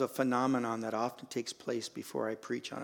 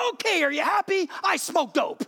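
put-on high, loud, put-upon teen voice; sulky confession; 8 dB louder than usual. Son grudgingly confessing to his dad, high and sulky. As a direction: give in resentfully. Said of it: "he goes".

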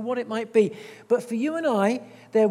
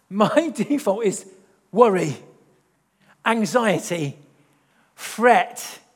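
louder, slow, laughing self-mockery; wry confession; 7 dB louder, slower than usual. Real, not acted. Preacher admitting his own worry to the church, louder and wry.

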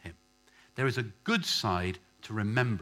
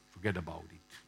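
low, softer fake Italian gangster; terrible Italian accent; 5 semitones lower, 7 dB quieter than usual. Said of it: "In terrible Italian accent".

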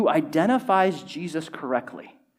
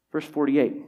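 ordinary talk, nothing marked

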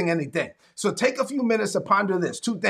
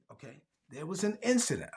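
softer, careful report of an incident; hushed report; 2 semitones lower, 9 dB quieter than usual. Retold or imitated. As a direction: break the news gently and quietly. Church worker voiced quietly.

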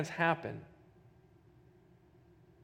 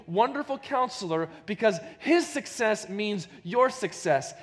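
louder rising preacher's build; preaching; 5 semitones higher, 4 dB louder than usual. Acted. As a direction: drive it home like a preacher at full force. Preaching climax to congregation.

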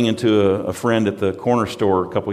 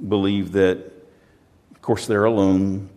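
ordinary talk

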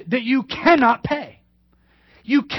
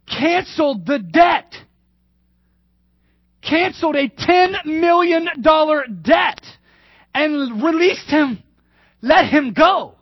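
triumphant proclamation; 6 semitones higher than usual. Real, not acted. Preacher proclaiming the king cancelled the debt, triumphant shout. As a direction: announce the good news with joy.

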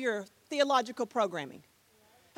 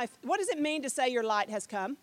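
normal talking voice

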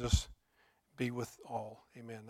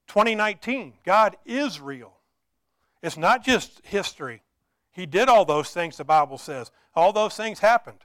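raised, insistent sermon delivery; impassioned preaching; 3 semitones higher, 9 dB louder than usual. Real, not acted. Preacher tells the congregation God is real today, impassioned preaching. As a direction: press each point home on a lifted pitch.

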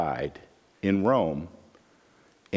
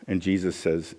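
ordinary talk

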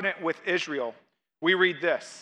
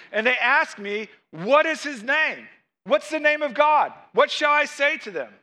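building to high, urgent questions; dramatic scripture reading; 5 semitones higher, 3 dB louder than usual. Acted. Preacher reads Moses questioning God, dramatic and rising. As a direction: raise the pitch with each question.

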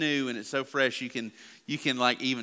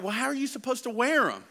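higher, bewildered protest; baffled dad; 10 semitones higher than usual. Retold or imitated. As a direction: throw up your hands. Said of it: "And I'm like".

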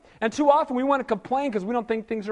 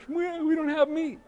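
a higher, softer whiny voice; mock whining; 6 semitones higher, 5 dB quieter than usual. Whiner in Moses' camp complains, softly whiny. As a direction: whimper it like a sulking whiner. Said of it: "three million whiners".

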